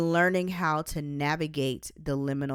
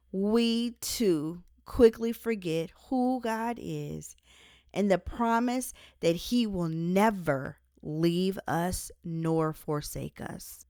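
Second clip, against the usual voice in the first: usual speaking voice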